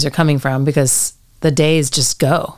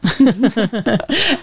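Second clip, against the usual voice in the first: short high laugh; quick amused laugh; light laugh; 7 semitones higher than usual. Real, not acted. Interviewer laughs after thanks.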